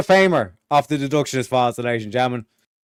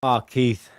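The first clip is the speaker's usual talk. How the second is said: ordinary delivery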